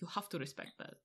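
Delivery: put-on a lightly done Russian accent, murmured; mild Russian accent. Old man approving her vodka, in a light Russian accent. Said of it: "he had this heavy Russian accent".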